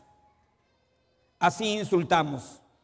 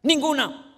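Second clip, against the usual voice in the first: "Ninguna" said high and alone; stress on Ninguna; 6 semitones higher than usual. Real, not acted. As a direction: hit "Ninguna" as its own word. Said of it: "Ninguna palabra corrompida".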